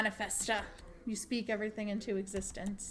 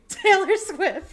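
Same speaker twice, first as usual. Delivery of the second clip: slow, loud, higher grand announcement; dramatic announcement; 9 semitones higher, 13 dB louder, much slower than usual. Pretend. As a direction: proclaim the name like a headline. Dramatic return to topic.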